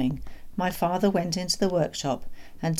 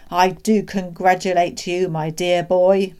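excited cry; 8 dB louder than usual. A narrator's reading. Doctor pumping the boy's hand, excited and loud. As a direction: congratulate with great gusto. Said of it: "he cried".

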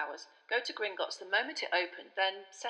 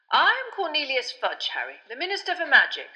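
higher, louder formal introduction; the Minister introduces himself; Fudge introducing himself; 7 semitones higher, 8 dB louder than usual. A narrator's reading. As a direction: announce yourself importantly.

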